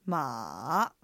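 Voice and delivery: "まあ" dipping low, then rising; third tone. Shown as a demonstration. Co-host models third tone, low dip then rise. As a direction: sink "まあ" right down and bring it back up. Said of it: "下がって上がる音です".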